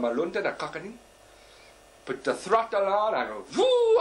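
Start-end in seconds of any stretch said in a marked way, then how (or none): 3.6-4.0 loud, high "woo" of an engine taking off; imitated jet ski whoosh. Snorkeler dragged off by the jet ski, high whoosh. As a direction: whoop like a jet ski at full throttle.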